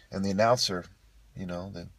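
usual speaking voice

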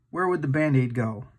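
ordinary delivery

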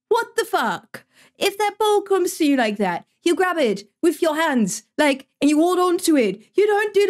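high-pitched exasperated rant; pissed off. Real, not acted. Streamer telling the goalie to grab the ball, pissed-off rant. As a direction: berate them in high, indignant bursts. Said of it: "I am so fucking pissed".

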